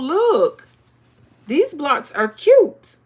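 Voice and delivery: ordinary talk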